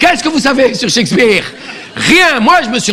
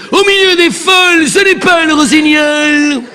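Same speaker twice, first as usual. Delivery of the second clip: hammed-up tragedienne; melodramatic Juliet; 3 semitones higher than usual. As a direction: overact a wailing Juliet.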